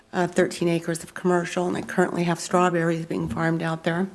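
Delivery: ordinary talk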